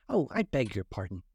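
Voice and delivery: plain reading voice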